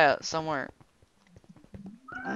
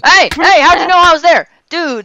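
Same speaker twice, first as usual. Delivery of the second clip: loud startled outcry; surprised shout; 9 semitones higher, 10 dB louder than usual. Real, not acted. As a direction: yell it, caught off guard. Caught hiding, shouts at finder.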